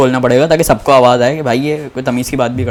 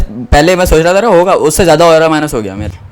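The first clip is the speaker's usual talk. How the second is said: normal talking voice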